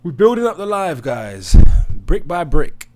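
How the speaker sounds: loud, high hype shout to the crowd; rally the viewers at full volume, high and punchy; pumped streamer call-out; hyped-up rallying. Real, not acted. Hyping the stream audience.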